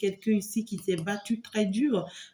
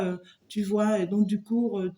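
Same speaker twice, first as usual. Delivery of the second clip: ordinary talk, nothing marked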